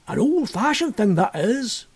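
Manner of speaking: slow rustic innkeeper voice; an old Suffolk landlord, unhurried; lightly voiced village innkeeper; country landlord. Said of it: "said the landlord".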